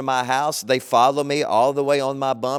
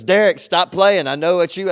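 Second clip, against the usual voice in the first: loud, higher, refusing to believe him; insistent stranger; 4 semitones higher, 5 dB louder than usual. Retold or imitated. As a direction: push back, laughing it off. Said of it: "He goes".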